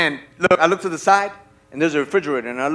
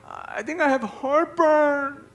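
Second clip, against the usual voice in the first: high, slow, pained complaint; whiny wife impression; 9 semitones higher, 6 dB quieter, much slower than usual. Wife complaining to husband at night, mock whine.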